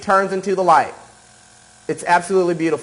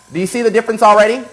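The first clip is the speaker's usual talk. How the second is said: high, fast, eager question; quick bright teacher's boast; eager showing off; 5 semitones higher, 4 dB louder, much faster than usual. Real, not acted. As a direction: push them to notice, excited. Showing result excitedly.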